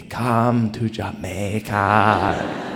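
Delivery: slow, drawn-out seductive call of the sea. Pretend. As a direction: lure him in like a tourism ad, stretched out slowly. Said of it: "as though the water were speaking to me".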